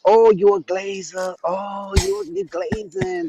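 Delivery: sing-song mocking voice, rising high; mocking taunt. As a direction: tease them like a playground taunt.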